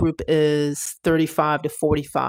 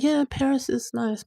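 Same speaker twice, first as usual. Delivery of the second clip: higher, offhand mock shrug; dismissive; 8 semitones higher than usual. Imitating the husband's friends shrugging off Paris, higher and offhand. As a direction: brush Paris off like it barely matters. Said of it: "They're like".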